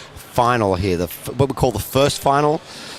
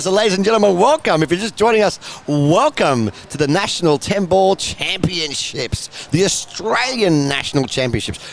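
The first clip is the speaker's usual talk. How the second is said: announcer welcome; 8 semitones higher than usual. Acted. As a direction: roll out the big welcome like a ring announcer. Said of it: "Should I say it in the Australian accent?".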